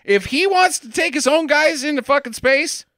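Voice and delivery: high-pitched, emphatic mock concession; mock NASA staff. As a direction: play over-eager officials conceding, high and loud. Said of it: "And, and they're like".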